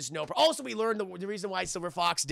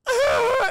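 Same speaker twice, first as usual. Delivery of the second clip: retold scream; 11 dB louder than usual. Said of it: "She's like".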